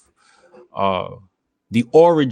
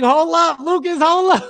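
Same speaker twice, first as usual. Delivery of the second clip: high, urgent mock plea; much higher half-laughing hold up; 4 dB louder than usual. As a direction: rein a friend in fast. Group calming Lucas.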